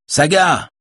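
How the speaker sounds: short high cry of a name; calling out. A narrator's reading. Yona calls to Saga, high moderate shout. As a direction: call out to her, pitch jumping up. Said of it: "crie Yona".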